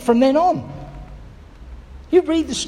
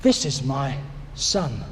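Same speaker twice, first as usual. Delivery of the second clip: tender blessing; 8 semitones lower, 5 dB quieter than usual. Pretend. God's words, slow, soft, lower.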